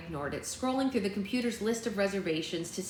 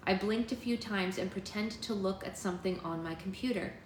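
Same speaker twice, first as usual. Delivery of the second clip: usual speaking voice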